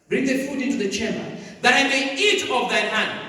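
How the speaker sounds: loud, high preacher's declamation; ringing pulpit reading, building in force; forceful scripture reading. A narrator's reading. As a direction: proclaim the Bible verse to the congregation. Reads scripture aloud with force.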